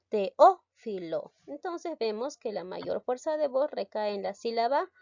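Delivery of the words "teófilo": "teófilo" drawn out slowly with its stressed syllable leaned on; slow demonstration read of "teófilo"; stressed syllable stretched out. Shown as a demonstration. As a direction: slowly sound out "teófilo" to show where the stress falls. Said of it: "reconozco la sílaba tónica".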